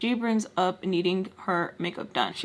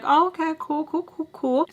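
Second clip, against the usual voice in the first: forced politeness; 8 semitones higher than usual. Retold or imitated. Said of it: "I'm like".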